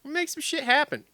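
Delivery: high, eager repeat; hammed-up eager.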